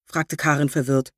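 plain reading voice

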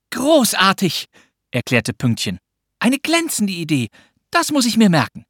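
put-on high-pitched girl's voice; delighted little girl. A narrator's reading. Pünktchen praises Anton's idea, high and delighted. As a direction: voice an impressed girl, pitched well up. Girl's lines in narrated dialogue.

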